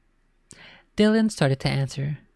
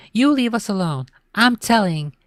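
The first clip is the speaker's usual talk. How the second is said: higher whiny complaint; whining; 7 semitones higher, 4 dB louder than usual. A narrator's reading. Little sister threatening to tell on brother, whiny complaint. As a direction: whine out the threat. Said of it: "Ashley whined".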